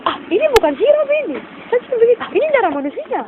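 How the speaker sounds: high-pitched, startled outcry; alarmed exclamation. Retold or imitated. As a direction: retell the shock in a high voice. Startled discovery that drink is blood. (seruan kaget bernada tinggi)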